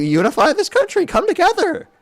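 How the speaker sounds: high, hammed-up pleading with the crowd; mock speechmaker. Debater parodying a supporter blaming Democrats, high mock speechmaker. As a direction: push it way up into a parody of earnest appeal.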